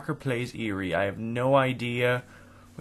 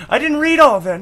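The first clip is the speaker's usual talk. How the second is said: high, loud outburst of protest; protesting yell; 10 dB louder, faster than usual. Real, not acted. Player protesting at vanished game text, high loud yell. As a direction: cry out in sudden complaint.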